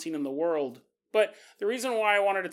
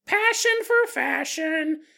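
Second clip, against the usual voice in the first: high, slow, louder doll voice; talking doll; 9 semitones higher, 5 dB louder, slower than usual. Pretend. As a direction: chirp it like a toy's catchphrase. Doll catchphrase voice.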